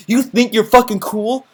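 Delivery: mocking cool kid.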